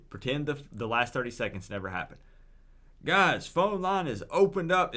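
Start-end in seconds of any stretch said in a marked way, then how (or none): 3.0-4.9 energetic redo. Real, not acted.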